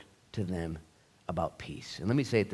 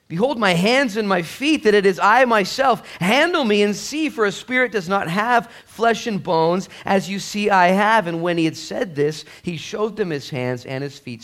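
high, loud preached reading of the verse; scripture reading; 10 semitones higher, 8 dB louder than usual. Acted. Pastor reading scripture aloud to the congregation, loud and high. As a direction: proclaim the passage to the church.

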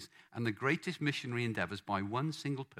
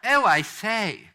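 loud, high, drawn-out posh English; much posher royal; 7 semitones higher, 11 dB louder, slower than usual. Shown as a demonstration. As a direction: exaggerate the posh royal accent, slow and ringing. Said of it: "much posher than that".

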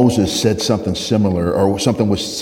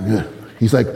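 normal talking voice

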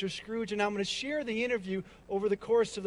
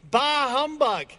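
loud, higher-pitched "Bah humbug"; passionate exclamation; 4 semitones higher, 7 dB louder than usual. Shown as a demonstration. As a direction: belt it out with passion for a crowd to repeat. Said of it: "say that with a little more passion".

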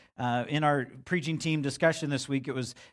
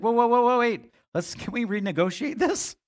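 higher and louder, mock alarm; mock panic; 9 semitones higher, 4 dB louder than usual. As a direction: backpedal in hammed-up panic. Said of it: "I'd be like".

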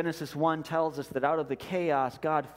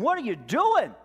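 loud, high, desperate cry at God; screaming at God; 12 semitones higher, 7 dB louder than usual. Retold or imitated. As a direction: shout it up to heaven in anguish. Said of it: "scream at him".

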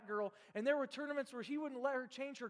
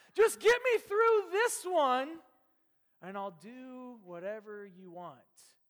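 mock desperate prayer; 2 semitones higher, 9 dB louder, slower than usual.

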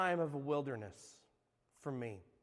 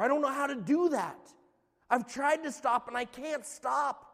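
higher-pitched, frustrated protest; exasperated; 6 semitones higher, 3 dB louder than usual. Acted. Struggling believer answering church advice, exasperated. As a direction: plead helplessly against the advice. Acts out his frustrated past reply.

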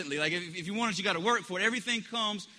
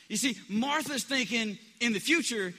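normal talking voice

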